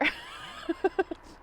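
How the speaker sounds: bright high burst of laughter. Real, not acted. Flattered by Adam, laughing high. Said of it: "you're such a charmer".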